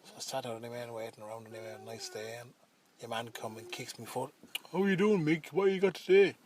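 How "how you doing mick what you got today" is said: higher and louder, taunting swagger; cocky bully. Retold or imitated. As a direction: greet him like a bully about to rob you. Bully confronts narrator.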